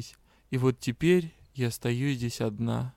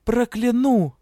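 higher, louder curse threat; 9 semitones higher, 7 dB louder, slower than usual. A narrator's reading. Heroine threatens the magister back, loudly. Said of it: "пригрозила".

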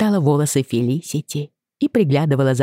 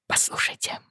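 urgent whisper; 10 dB quieter than usual. A narrator's reading.

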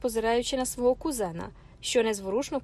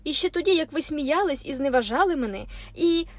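higher, louder angry protest; heated outburst; 5 semitones higher, 4 dB louder than usual. A narrator's reading. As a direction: let it boil over.

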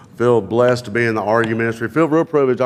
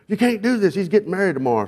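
higher-pitched, urgent mock objection; acted protest; 9 semitones higher than usual. Best man protests shaving the groom's leg, acted protest.

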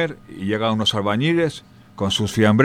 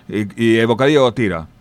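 usual speaking voice

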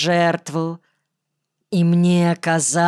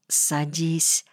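полушёпот; 6 dB quieter than usual. A narrator's reading. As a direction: say it softly, under your breath. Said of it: "полушёпотом произнесла она". (негромко, полушёпотом)

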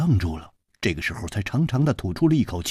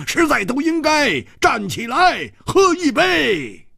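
hawker's shout. Acted. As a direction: cry it out like a quack selling plasters. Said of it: "指着楚留香大叫道".

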